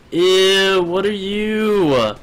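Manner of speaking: high, slow drawn-out disgust; grossed-out. Real, not acted. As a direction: recoil with a long, high whine. Meets disgusting new creature.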